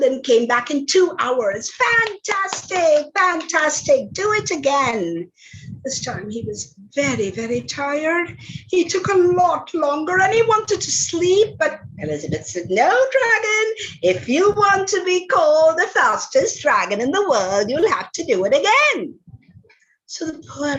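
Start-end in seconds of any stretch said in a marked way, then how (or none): 1.7-5.3 higher and slow, settling down by again; a princess goading the dragon with praise; gushing praise. Acted. 12.7-19.1 a princess pushing the tired dragon to run again; bossy insistence. Acted.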